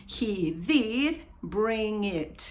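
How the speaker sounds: slow, long DID, spaced words; emphatic auxiliary DID. Shown as a demonstration. Teacher shows the auxiliary, slow with long DID. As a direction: stretch DID deliberately. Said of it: "usamos su auxiliar".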